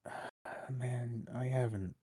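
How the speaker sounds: mock reluctant, halting reply; hesitant stalling. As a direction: stall as if unsure of the answer. Imagined Tony Khan dodging a question.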